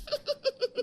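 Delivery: high giggling laugh; cackling in-law's giggle; mocking laughter. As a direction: titter gleefully at someone's expense. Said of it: "जोर से हंसने लगती है".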